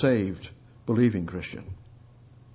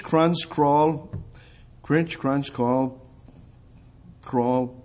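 normal talking voice

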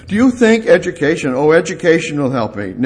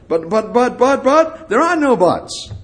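mocking objections; 9 semitones higher than usual.

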